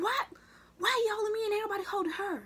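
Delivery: high, loud outraged question; furious protest. Retold or imitated. A girl protesting to the teacher holding her, high and outraged. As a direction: demand an answer from the teacher, outraged. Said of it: "I was so mad".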